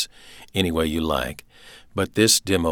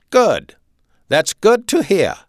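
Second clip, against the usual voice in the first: loud, high German officer; authoritarian, punched-out German accent; staccato German accent; 14 semitones higher, 8 dB louder, slower than usual. A narrator's reading. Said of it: "he spoke with a staccato German accent".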